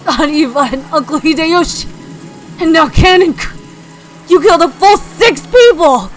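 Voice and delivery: high, loud anguished fury; loud furious wail; crying out. Acted. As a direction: scream your grief at the killer. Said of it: "as he cried".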